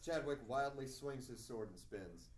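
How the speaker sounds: ordinary delivery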